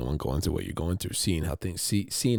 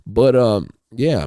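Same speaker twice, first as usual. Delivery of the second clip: normal talking voice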